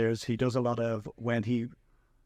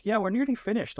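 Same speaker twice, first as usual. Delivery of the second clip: high chirpy reassurance; 10 semitones higher than usual. Retold or imitated. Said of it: "And I was like".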